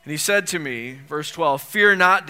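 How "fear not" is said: fear not, called out high and loud; loud, commanding reading. Acted. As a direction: boom the angel's command. Angel's command read aloud.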